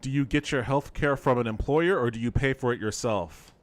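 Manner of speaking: ordinary talk, nothing marked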